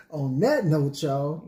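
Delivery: laughing. Real, not acted. Laughs through sign-off.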